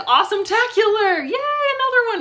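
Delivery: high, loud celebratory squeal; hyped catchphrase cheer. Acted. Reviewer giving another book the top rating, excited high cheer.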